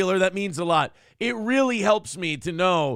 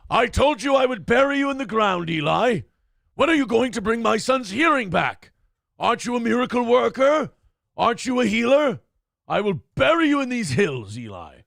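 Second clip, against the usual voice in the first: louder, higher, mock-threatening taunts; menacing preacher-baiting rant; 5 semitones higher, 3 dB louder than usual. To Eli Sunday in chat, hammed-up menacing threat. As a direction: hammer him like a bitter oilman.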